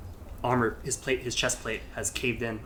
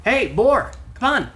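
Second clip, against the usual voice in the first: higher, louder call to wake someone; higher and louder Ravanica calling Boar to wake; Ravanica waking him; 10 semitones higher, 7 dB louder than usual. Acted. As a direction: rouse him awake, urgently. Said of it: "It's like".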